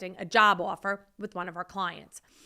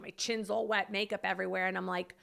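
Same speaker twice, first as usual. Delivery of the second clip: ordinary talk, nothing marked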